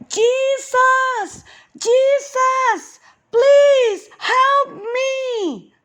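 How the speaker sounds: high, crying shout for help, last words drawn out; desperate yell. Acted. Blind man yells to Jesus over the crowd, pleading. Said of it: "Bartimaeus yelled loudly".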